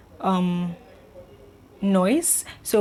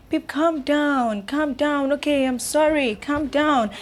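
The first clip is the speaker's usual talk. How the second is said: slow, higher coaxing to settle her; soothing boyfriend; 7 semitones higher, slower than usual. Retold or imitated. Boyfriend calming his screaming girlfriend, slow soothing. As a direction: gently talk her down, unhurried.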